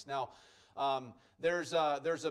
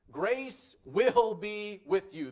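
"will" stressed, slow and louder; 3 semitones higher, 5 dB louder, slower than usual. Shown as a demonstration. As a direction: hit "will" as a sure promise. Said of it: "It is an emphatic statement".

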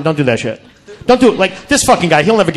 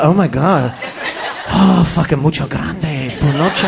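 leering sound guy; 8 semitones lower, 5 dB quieter, slower than usual. Retold or imitated. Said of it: "looking at Mo when he was jerking off".

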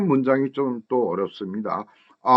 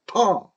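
"터" stretched with a higher pitch; stressed syllable; 6 semitones higher than usual. Shown as a demonstration. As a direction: put the stress on "터", drawn out. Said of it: "어에 강세가 있죠".

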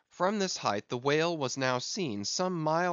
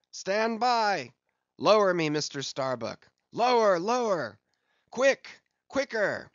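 high, loud, carrying commands; urgent shouted orders; 7 semitones higher, 5 dB louder than usual. A narrator's reading. Captain demanding to be lowered to the boats, shouting. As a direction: call out each order with rising urgency.

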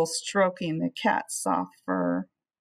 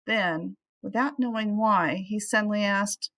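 ordinary delivery

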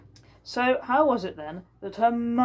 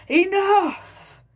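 much higher, much louder cry; angry outburst; 14 semitones higher, 10 dB louder than usual. Acted. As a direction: cut everyone off at once. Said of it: "cried the governor".

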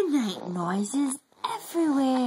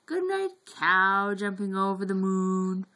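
usual speaking voice

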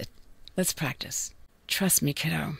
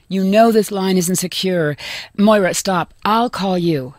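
loud, firm telephone brush-off; urgent rebuke; 9 dB louder than usual. Acted. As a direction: shut the caller down forcefully. Cuts off unwanted caller urgently.

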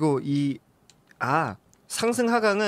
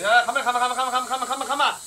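excited high calling; 9 semitones higher, faster than usual. Real, not acted. Urging the hovering drone.

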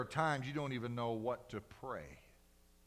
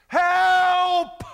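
desperate high shout for help; cry for help; 16 dB louder than usual. Retold or imitated. Preacher reenacting his own prayer, a desperate shouted cry. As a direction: cry out to God with one word, full voice. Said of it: "I've called out to God that way".

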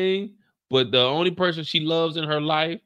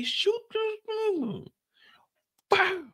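mock exasperated; 5 dB quieter, slower than usual. High exasperated imitated plea.

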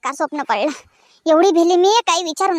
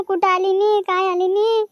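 usual speaking voice

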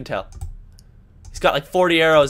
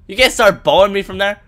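normal talking voice

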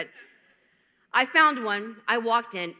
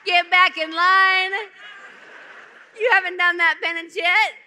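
imagined priest scolding; 9 semitones higher, 10 dB louder than usual. Pretend. Priest imagined ordering a sinner out of communion line, yelling. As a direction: holler it hammed-up, stretching the words. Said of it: "he'll be like".

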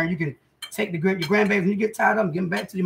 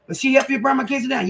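1 mom scolding; 7 semitones higher, 3 dB louder than usual. Retold or imitated. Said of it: "They be like".

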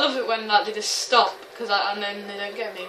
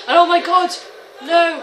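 loud, high-pitched alarm; sudden panic, pitched up; panicked shout; 8 semitones higher, 11 dB louder than usual. Real, not acted. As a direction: cry out as the shooting starts. Comes under fire in game.

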